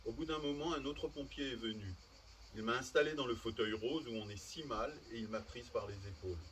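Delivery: usual speaking voice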